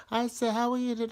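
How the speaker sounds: put-on high, bright kid's voice; student greeting. One student passing by, high cheery greeting. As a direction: chirp it like a cheerful pupil. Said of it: "one of them going".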